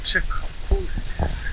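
soft breathy whisper. Real, not acted. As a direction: whisper it like a secret. Hushed aside on the beach.

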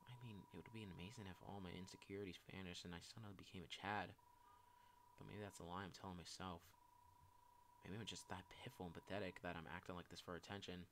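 soft, downcast musing; dejected self-doubt. Acted. Insecure person wondering if they fake it, dejected self-doubt.

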